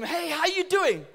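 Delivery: over-the-top happy hello; cheery greeting. Acted. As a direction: greet them with big cheer. Cheerful greeting to gloomy person.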